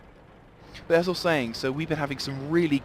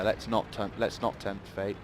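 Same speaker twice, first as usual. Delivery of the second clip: ordinary talk, nothing marked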